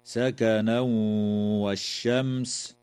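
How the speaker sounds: سكنا والشمس with the tanween merged into the و, nasalised; nasal merge of سكنا والشمس; idgham with ghunna. Shown as a demonstration. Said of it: "يدغم التنوين في الواو مع الغنة".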